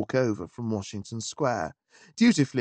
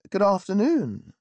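bright, cheerful greeting, higher; cheerily; 7 semitones higher, 3 dB louder than usual. A narrator's reading. Visitor greeting the butler cheerfully. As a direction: chirp it out with good cheer. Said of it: "I said cheerily".

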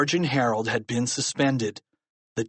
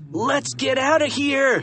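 high, urgent cry to flee; 13 semitones higher, 3 dB louder than usual. A narrator's reading. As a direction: yell it in alarm. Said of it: "he cried".

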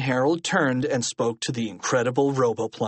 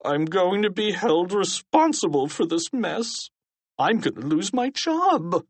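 higher-pitched self-pitying whine; whining; 7 semitones higher than usual. A narrator's reading. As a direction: whine about your fate. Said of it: "Principal Krupp whined".

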